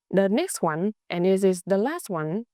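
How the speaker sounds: normal talking voice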